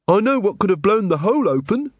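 high, lilting suggestion; lighter-pitched constable venturing an idea; Angua, keen constable. Acted.

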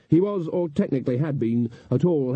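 usual speaking voice

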